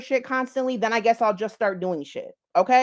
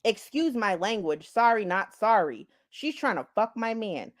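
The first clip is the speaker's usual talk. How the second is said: normal talking voice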